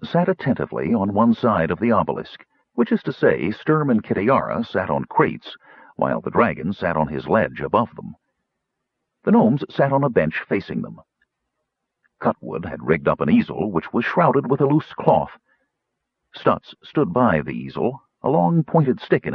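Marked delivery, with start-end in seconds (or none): none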